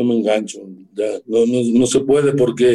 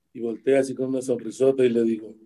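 usual speaking voice

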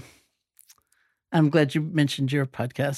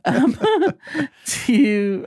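teary laugh; 8 semitones higher, 5 dB louder than usual. Real, not acted. Host moved to tears, laughing.

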